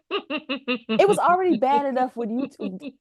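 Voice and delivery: ordinary talk, nothing marked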